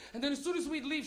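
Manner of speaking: normal talking voice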